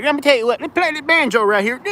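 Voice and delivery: high hammed-up hillbilly voice; mock country accent. Joking to viewers about going country, hammed-up Southern accent. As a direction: ham up a banjo-playing country boy. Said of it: "I'm gonna be like".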